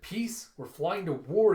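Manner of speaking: high-pitched disbelief turning loud; incredulous outburst. Acted. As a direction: scoff indignantly at the very idea. Acted warlord scoffing at peace plan.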